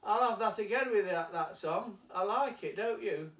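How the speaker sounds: Larry shouting his request as he walks away; retold shout. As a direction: call back over your shoulder to the band. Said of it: "he shouts".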